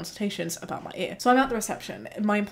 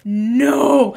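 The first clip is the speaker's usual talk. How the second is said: desperate no; 3 semitones higher, 10 dB louder than usual. Retold or imitated. Pleading with the receptionist against six months' wait, loud no. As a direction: refuse in a panic. Retold plea to upstairs receptionist.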